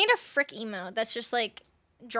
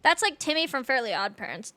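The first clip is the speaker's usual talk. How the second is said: ordinary talk, nothing marked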